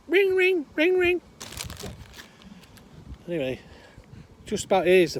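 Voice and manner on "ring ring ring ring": piercingly high, loud parrot ringing; parrot mimicking phone. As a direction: squawk the phone's ring like a parrot. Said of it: "Parrot goes".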